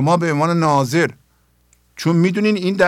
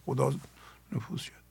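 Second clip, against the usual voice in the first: breathy whisper, almost no voice; 4 semitones lower, 16 dB quieter than usual. Shown as a demonstration. Teacher whispers the phrase to the listeners. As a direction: let the words fade into a hushed whisper. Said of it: "بگین".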